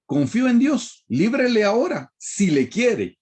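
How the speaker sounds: high mocking sneer, stretching out si le quiere slowly; sarcastic taunt. Preacher voices the crowd taunting Jesus on the cross, high sarcastic sneer. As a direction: taunt him from below the cross, sing-song scorn. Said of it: "Eso es con sarcasmo".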